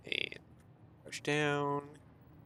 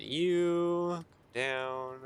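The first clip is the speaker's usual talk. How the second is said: drawn-out high coaxing; high, slow sing-song; 10 semitones higher than usual. Pretend. Talking to conveyor pieces.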